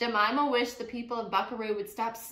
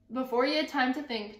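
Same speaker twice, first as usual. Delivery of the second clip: plain reading voice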